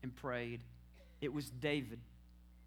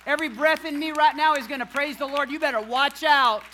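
shouted, high and loud praise over the crowd; full-force preaching; 8 semitones higher, 15 dB louder than usual. Real, not acted. Pastor to the congregation, shouting praise at full force. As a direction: preach it out at the top of your lungs.

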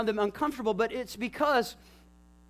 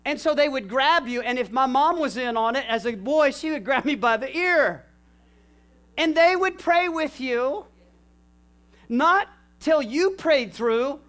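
ordinary delivery

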